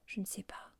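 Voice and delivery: a hushed, unvoiced whisper; murmured. A narrator's reading. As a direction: barely breathe the words out, ashamed. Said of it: "puis murmura".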